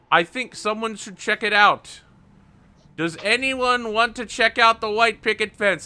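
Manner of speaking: high, loud, slow elder voice; high-pitched elder calling for calm; old elder rabbit calming crowd. Acted. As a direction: settle the room like the old leader.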